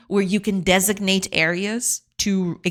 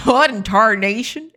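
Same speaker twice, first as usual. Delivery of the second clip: cowboy voice; 2 semitones higher, 4 dB louder than usual. Pretend. Cowboy bit continues.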